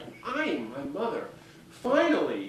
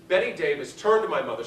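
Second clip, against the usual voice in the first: ordinary delivery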